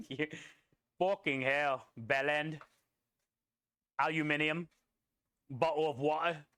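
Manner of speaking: light mock British accent, clipped English vowels; bad English accent. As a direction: do a jokey, half-hearted English accent. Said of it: "I do a horrible English accent".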